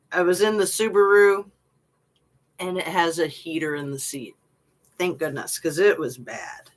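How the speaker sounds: ordinary talk, nothing marked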